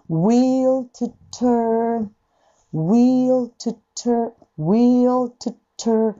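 slow drawn-out will to turn; ゆっくりwill to turn; slow demo. Shown as a demonstration. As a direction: stretch will to turn right out. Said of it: "トゥーの後半が重いのね".

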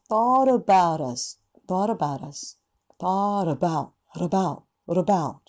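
thought about us with a flapped t and relaxed schwa; schwa flap demo. Shown as a demonstration. Teacher slowly modelling thought about us. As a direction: let about us roll with a flapped t. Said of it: "うっと体を鳴らすような音".

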